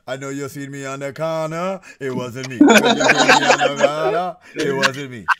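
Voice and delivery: laid-back dancehall singer voice. Retold or imitated. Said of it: "my Shaggy impression is like this".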